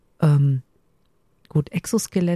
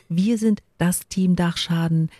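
ordinary delivery

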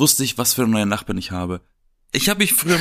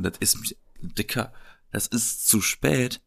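soft, slow half-whispered scolding; muttered retort; 2 semitones lower, 7 dB quieter, slower than usual. Retold or imitated. Annoyed customer muttering to the late movers, soft and slow. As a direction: mutter it under your breath, slowly. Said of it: "Da wollte ich fast schon sagen".

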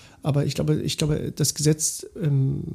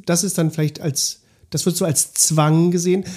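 ordinary talk, nothing marked

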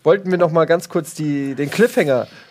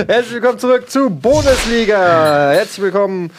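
upbeat show host greeting; host welcome; 5 semitones higher, 5 dB louder than usual. Acted. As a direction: announce it like a TV host. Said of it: "show host welcome".

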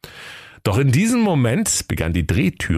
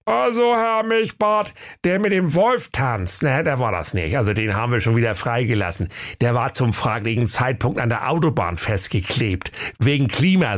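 high-pitched reporting sidekick; comic knight-officer figure; Prinz Meisenherz; 6 semitones higher than usual. A narrator's reading. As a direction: report to the boss in a high put-on voice. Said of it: "Der Ritter Prinz Meisenherz sprach".

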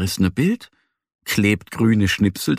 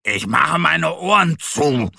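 higher-pitched, huffy little bear; angry snort; 6 semitones higher than usual. A narrator's reading. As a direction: huff it out crossly. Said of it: "schnaubt wütend".

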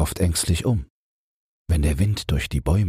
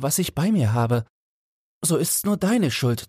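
put-on much higher, anxious young man's voice; high pitched fretful Felix blaming his friend; fearful young goldsmith; 10 semitones higher than usual. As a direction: plead it, scared of the dark woods. Felix frightened on dark road.

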